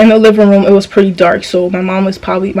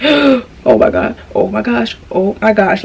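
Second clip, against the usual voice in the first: terrified murmur; 4 dB quieter, slower than usual. Retold or imitated. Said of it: "And I'm over here like".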